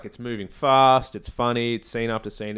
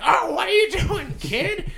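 very high, louder squawking protest; mock exasperation; 5 dB louder, slower than usual. Manager despairs at the kid's outburst, high mock squawk. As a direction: shoot way up in cartoonish dismay. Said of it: "And he's like".

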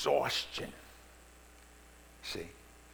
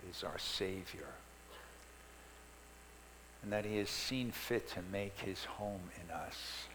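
soft, lower, reverent prayer voice; 6 semitones lower, 6 dB quieter than usual. Acted. Pastor leading the congregation in quiet prayer. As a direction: pray quietly and humbly.

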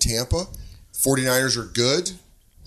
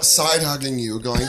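usual speaking voice

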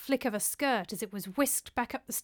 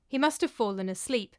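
ordinary delivery

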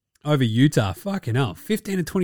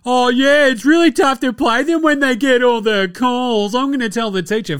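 higher, louder mock sook whine; mocking whiny player; 7 semitones higher, 3 dB louder than usual. Host mocking a star's complaints about calls, whiny. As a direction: whinge like a sulking kid telling on someone. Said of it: "sookie la la territory".

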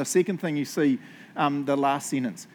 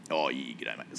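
very low, softer builder greeting; 14 semitones lower, 5 dB quieter than usual. Acted. Preacher showing the congregation how builders talk, deep builder voice. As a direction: sink deep into a builder's g'day. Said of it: "You know how builders are always like".

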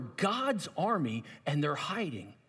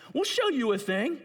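taunting repeat; 8 semitones higher, 4 dB louder than usual. Acted.

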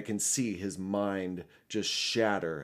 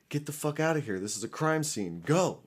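higher, pressing retold command; urgent push, landing hard on "Go"; urgent urging; 7 semitones higher than usual. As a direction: mimic panicked friends telling you to clear out. Friends urging D to flee, retold.

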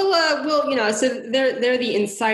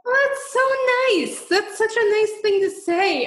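delighted; 13 semitones higher than usual. Real, not acted. Touched by cohost's remark.

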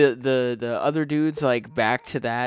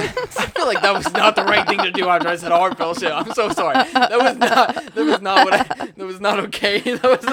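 higher, louder, cracking up through the words; laughing apology; 7 semitones higher, 6 dB louder than usual. Real, not acted. Host apologising after saying heartfelt shit, real laughter. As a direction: apologise while helplessly laughing.

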